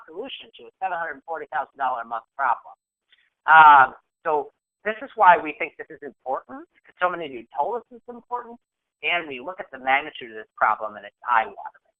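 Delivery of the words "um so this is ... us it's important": ordinary talk, nothing marked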